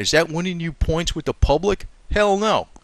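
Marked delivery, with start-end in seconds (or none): none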